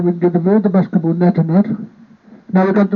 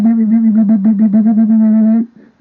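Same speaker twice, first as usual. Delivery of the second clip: quick, slightly raised mock beeps like a machine; imitated beeping; 3 semitones higher than usual. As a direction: beep it out like a ringing ear. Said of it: "they go beep".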